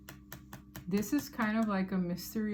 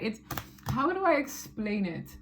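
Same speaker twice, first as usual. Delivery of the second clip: ordinary talk, nothing marked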